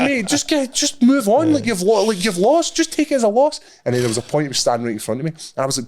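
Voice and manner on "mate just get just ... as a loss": high, loud, exasperated telling-off; mock weary dismissal; exasperated brush-off. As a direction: wave him off like a pest. Telling the fan off, retold.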